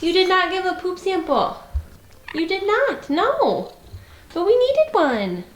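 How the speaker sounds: high mock scolding of the cat. Real, not acted. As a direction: playfully tell the kitty off.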